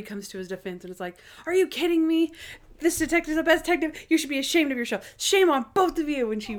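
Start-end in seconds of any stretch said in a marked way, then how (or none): 1.5-6.3 high, fast indignant woman; indignant Natalie. Retold or imitated. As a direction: scold them in a high, offended voice. Natalie voiced defending Monk.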